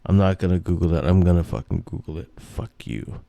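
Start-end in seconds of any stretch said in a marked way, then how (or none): 1.4-3.2 low whispered fuck-off under his breath; whispered curse. Real, not acted. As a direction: hiss it to yourself in frustration. Angry aside to himself.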